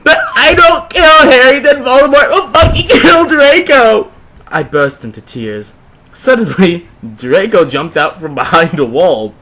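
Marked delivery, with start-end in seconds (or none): 0.0-4.1 high, loud hammed-up wail of distress; overacted teen despair, fast; tearful rant. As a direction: melodramatic goth girl complaining. Distressed heroine pours out troubles.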